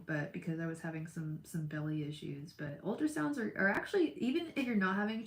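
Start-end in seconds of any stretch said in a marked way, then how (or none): none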